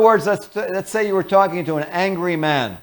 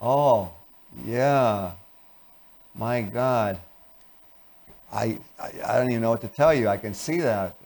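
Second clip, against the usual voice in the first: bored; 4 semitones lower than usual. Shown as a demonstration. Spouse coming home to an angry partner, bored. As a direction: drag every word out with no interest. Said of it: "You come in bored".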